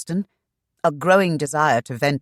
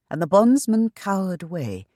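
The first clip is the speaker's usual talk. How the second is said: plain reading voice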